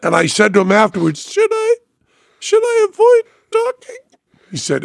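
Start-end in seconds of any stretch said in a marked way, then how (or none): 1.3-4.0 much higher, thin falsetto plea; squeaky broken voice. Retold or imitated. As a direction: ask in a cracked squeak. Imitating his broken voice.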